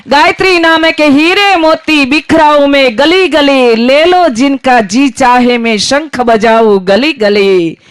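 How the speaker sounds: chanting like a pushcart seller; street hawker's cry. Acted. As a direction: cry your wares down the lanes. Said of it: "અમે પણ બૂમો પાડીએ".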